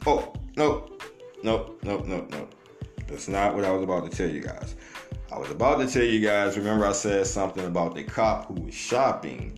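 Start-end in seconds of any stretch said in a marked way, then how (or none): none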